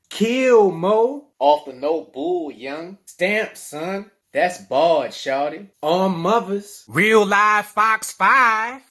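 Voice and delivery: shouted DC street slang, slowing down at the end. Shown as a demonstration. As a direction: hype each DC oath like on the corner.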